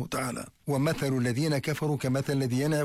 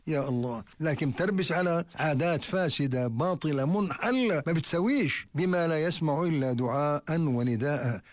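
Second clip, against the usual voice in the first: ordinary delivery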